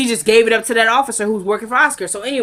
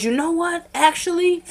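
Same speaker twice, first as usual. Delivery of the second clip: mock sudden realization; 9 semitones higher than usual. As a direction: let it dawn on him, high and hushed. Wyatt suddenly remembering.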